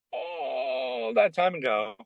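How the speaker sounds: long high drawn-out realizing voice; dawning realization. Retold or imitated. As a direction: let it slowly dawn on you, drawn out high.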